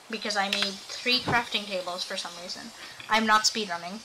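usual speaking voice

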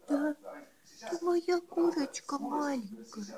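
high cooing praise. Real, not acted. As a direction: coo it up high to a pet. Cooing to a pet. (высокое сюсюканье)

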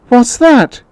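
put-on high, loud cry of a startled old woman. A narrator's reading. Old woman starting up at a knock in the night, startled cry. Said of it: "cried the old woman, starting up".

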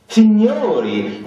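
high, drawn-out call of signori; shouting. A narrator's reading. Zirkov calling the company to attention, loud. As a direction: summon everyone loudly. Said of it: "gridò Zirkov".

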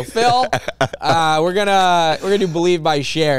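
high, loud, slowed start, nervously bold; excited announcement. Retold or imitated. Singer telling the producer their song in a high, loud voice. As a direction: announce it proudly to Phil.

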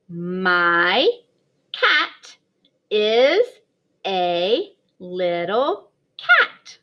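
slow word-by-word reading, stretched cat; slow read-aloud. Shown as a demonstration. Kindergarten teacher modelling reading, slow and clear. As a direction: read each word apart, slowly, for little ones. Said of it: "We could try to read the sentence".

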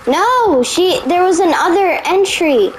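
high panicked cry while fleeing; being chased. Real, not acted. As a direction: yell in alarm, being hunted. Fleeing an enemy in game.

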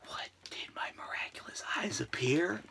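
hushed secret whisper. Real, not acted. Revealing a sneaky package, hushed whisper.

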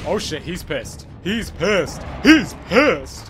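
building from normal to a loud yell; panicked alarm building to a shout; rising panic. Real, not acted. Boss enraged, player panicking.

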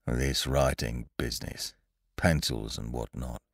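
low, soft, disgruntled grumble; muttering to himself. A narrator's reading. Eeyore left out of the delight, low soft muttering. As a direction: grumble under your breath. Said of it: "Eeyore was saying to himself".

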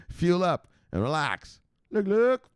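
high, drawn-out holler ending in a squeak; playful high-pitched wrangler's call; mock yelling. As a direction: order the crowd about, hammed up. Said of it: "what's he yelling at them".

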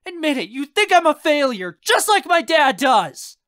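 high, loud accusation; furious shout. Acted. Raz accuses uncle of thinking failure, hurt shout. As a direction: hurl it at full voice, hurt. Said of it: "I shout".

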